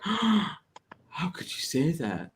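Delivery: gasp, then slow, drawn-out mock outrage. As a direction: ham up the clutched-pearls shock. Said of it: "And now you're like".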